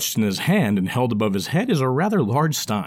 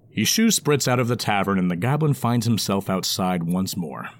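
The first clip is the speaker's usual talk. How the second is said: ordinary delivery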